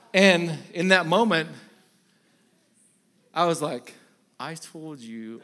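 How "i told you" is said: hushed, slow inner complaint; inner grumble. Retold or imitated. Preacher's silent complaint about his lunch, hushed and slow. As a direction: seethe quietly in your head. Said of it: "going on in my head".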